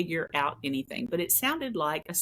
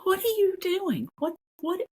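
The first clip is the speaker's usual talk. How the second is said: startled question; 12 semitones higher than usual. Retold or imitated. Startled by Mark calling her.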